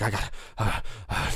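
mock panicked, breathless stammer; imitated freak-out. Camper acts out freaking out after falling from the car, panicky stammer. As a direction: cut off into gasps, acting out panic. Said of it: "like, just freaking out".

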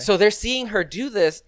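ordinary delivery

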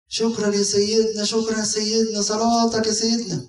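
high, gushing thanks from a relieved wife; grateful woman. Retold or imitated. A relieved wife running to thank the priest, high and gushing. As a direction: gush your thanks to the priest, pitched high.

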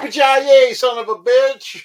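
high, loud action-hero shout; catchphrase shout. Acted. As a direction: belt it out like a battle cry. Quoting the Die Hard catchphrase.

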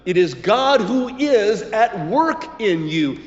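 ordinary delivery

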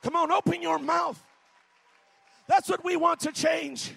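shouted revival sermon; preaching at full force. Acted. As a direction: preach it hard to the congregation.

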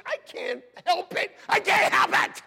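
high protest building to a shout; yelling. Acted. Self-confessed yeller excusing himself to the congregation, yelling. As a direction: raise it into a helpless yell. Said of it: "I'm a yeller".

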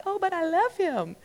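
high, soft, mock-whiny protest; lovesick protest. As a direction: play a lovesick girl's excuse. Pleading with her friend.

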